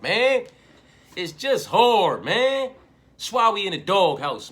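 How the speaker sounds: loud exasperated ranting; frustrated shout. Real, not acted. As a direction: hammer home how hard it is. Frustrated rant about hard picks.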